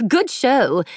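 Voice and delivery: high, bright laughing praise; laughing call. A narrator's reading. Girl laughing back over her shoulder as they slip past. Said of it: "laughingly threw over her shoulder".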